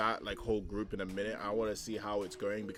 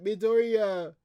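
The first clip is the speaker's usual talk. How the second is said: high, loud, acted call after someone; 9 semitones higher, 6 dB louder, slower than usual. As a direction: cry out the name as if chasing them. Acting a character calling after someone.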